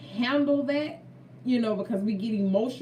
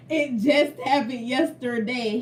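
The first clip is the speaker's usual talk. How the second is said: bursting into laughter, then amused emphasis; laugh, then draw the words out in amusement; 3 semitones higher, 4 dB louder than usual. Real, not acted. Telling viewers her advice just came true, laughing.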